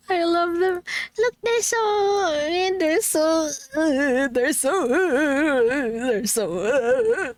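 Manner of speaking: high voice breaking with happy tears; choked up, can't finish the sentence; crying. Real, not acted. Streamer gushing to chat about gift art, crying happily. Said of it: "My crying is so bad".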